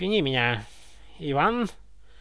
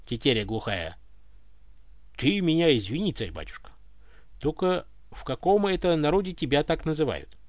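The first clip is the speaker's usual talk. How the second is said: ordinary delivery, nothing marked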